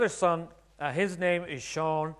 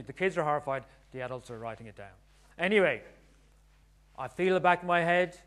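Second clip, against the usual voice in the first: ordinary talk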